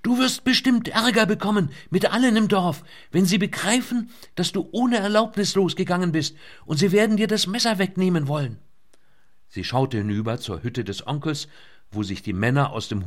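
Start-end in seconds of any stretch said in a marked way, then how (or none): 0.0-8.6 high, loud urgent shouting; over helicopter. A narrator's reading. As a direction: shout over the rotor noise. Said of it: "schrie Lynette gegen den Lärm der Rotoren an".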